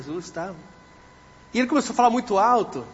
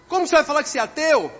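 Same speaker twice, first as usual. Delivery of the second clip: fast, high, raised question; rapid scoffing demand; incredulous challenge; 7 semitones higher, 4 dB louder, much faster than usual. Retold or imitated. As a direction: fire it at him in disbelief. Challenging the atheist.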